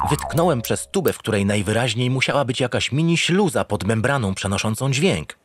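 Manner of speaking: usual speaking voice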